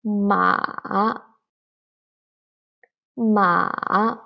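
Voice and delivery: MA going down, then up; dipping tone. Shown as a demonstration. Teacher showing the third tone, ma dipping. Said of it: "go down and go up".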